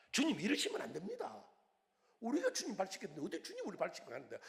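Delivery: high, loud and fast, like Peter refusing; disciple protesting loudly. Retold or imitated. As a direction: plead loudly, shocked that the Lord serves them. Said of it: "베드로 같은 제자가".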